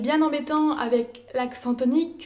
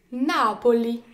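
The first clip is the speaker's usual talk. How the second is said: "Napoli" with NA stressed; stress third from last; 4 dB louder, slower than usual. Shown as a demonstration. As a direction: hit the first syllable of "Napoli". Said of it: "accentués sur l'avant-avant-dernière syllabe". (« Napoli » accentué sur NA)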